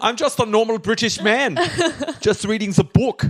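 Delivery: high, put-on German accent; German posing as British. Spy reading a book upside down, high German-accented cover voice. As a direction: protest innocence in a bad German-tinged British accent. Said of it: "talks with a British accent or a German accent".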